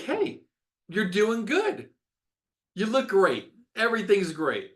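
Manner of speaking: high-pitched, drawn-out fake praise; mock reassuring doctor. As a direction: imitate a doctor insisting all is well. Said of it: "The doctor's like".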